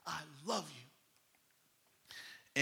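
whispered yes, then slow, soft declaration; hushed emphatic. Retold or imitated. Peter answering Jesus, hushed and emphatic. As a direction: draw it out softly, with intensity. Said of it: "he just emphatically said".

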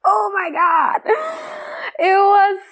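high, slow, laughing exclamation. Real, not acted. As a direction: gasp it out while laughing. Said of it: "I'm laughing my ass off".

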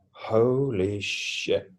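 slow, hushed amazement; retold awe. Recalling looking down on the universe, awed. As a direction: draw it out softly, awestruck.

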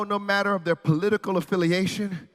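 ordinary talk, nothing marked

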